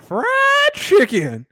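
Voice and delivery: exaggerated quote of her exclamation; mocking her delivery. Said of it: "it's the way she said it".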